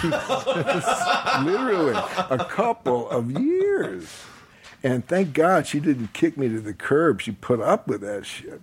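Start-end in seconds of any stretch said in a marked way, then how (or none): 0.0-4.1 much higher, slow, laughing words. Real, not acted. Admitting years of pouting to the host, laughing. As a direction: chuckle it out. Laughing at his own stubbornness.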